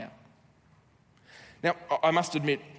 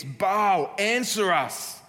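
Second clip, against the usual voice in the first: raised calling out to Baal; retold shout; 5 semitones higher, 4 dB louder, much slower than usual. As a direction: cry out loud to a silent god. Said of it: "they shouted".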